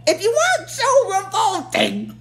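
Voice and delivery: villain queen mocking her revolting brother; sarcastic Rita. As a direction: tease him with a laughing put-down. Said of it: "she's like".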